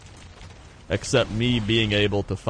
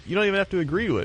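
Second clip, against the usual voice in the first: ordinary delivery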